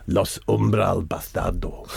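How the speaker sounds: low, slow fake Spanish accent; mock Spanish accent. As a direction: say it like a grand Spanish gang name.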